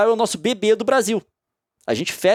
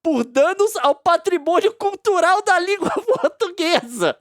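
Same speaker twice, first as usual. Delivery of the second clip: much higher, slow, incredulous voice; mock outrage; 8 semitones higher than usual. Scoffing at the ten-million fine for viewers. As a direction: ham up disbelief at the fine.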